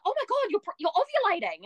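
high, excited blurt; excited gasp. Retold or imitated. The friend seeing the test line, a high excited blurt.

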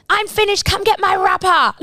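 high-pitched bratty child's yell, imitated; retold scream. Little girl summoning babysitter to fetch rubbish, mock scream. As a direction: holler it like a kid ordering someone about. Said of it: "Scream for me to go".

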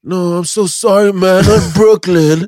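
high-pitched, drawn-out mock apology; apologetic drunk passenger. Drunk passenger correcting the address to the driver, exaggerated apology. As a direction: plead with the cabbie in hammed-up regret. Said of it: "Jag bara".

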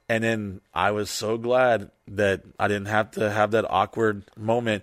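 normal talking voice

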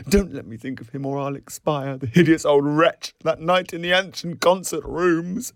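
high, pleading mock horror; praying to be spared. A narrator's reading. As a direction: beg dramatically, voice climbing. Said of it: "but prayed again".

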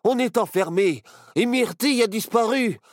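put-on panicked excited boy. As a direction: shake your friend awake, shouting. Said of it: "s'excita Barnabé".

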